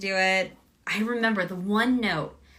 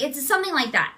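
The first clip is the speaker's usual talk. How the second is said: ordinary talk, nothing marked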